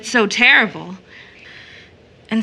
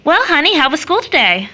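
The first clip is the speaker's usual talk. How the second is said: cheerful greeting; 6 semitones higher, 5 dB louder than usual. Acted. Mother greets child cheerfully.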